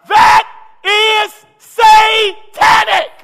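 loud, slowing, climbing preacher's cry; full-force preaching. Acted. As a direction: preach it to the rafters.

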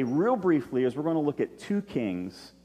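usual speaking voice

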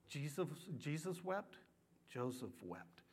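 soft, hushed scripture; gentle, grieving hush. Acted. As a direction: let the words fall quiet. Shortest verses, tender hush.

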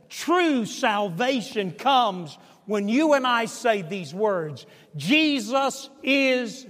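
Jesus is drawn out slowly, high and loud; drawn-out call. Shown as a demonstration. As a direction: stretch out Jesus is for the congregation to finish. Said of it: "say it".

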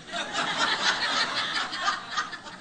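breathy burst of laughter; short unvoiced laugh; airy laugh. Real, not acted. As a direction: laugh at his own joke. Laughs at own story.

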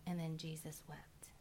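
hushed solemn whisper. A narrator's reading. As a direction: whisper it, barely voiced. Solemn reading of Jesus wept.

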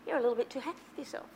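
soft, very fast little inner voice; a quick gentle nudge from within; small inner voice. Retold or imitated. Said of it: "I hear this little voice".

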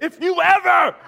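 furious yell, high and loud; angry shout. Retold or imitated. Father to found daughter, shouting angrily. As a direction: yell at her in sudden anger. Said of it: "I get angry".

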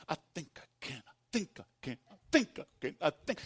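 very soft, halting chug; timid, unsure little engine; mock little engine. Preacher voicing the doubting believer as the little engine, soft. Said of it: "that little engine".

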